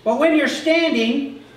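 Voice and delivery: loud, high preaching cadence; fervent preaching. Acted. Pastor urging the congregation to stand firm, fervent preaching.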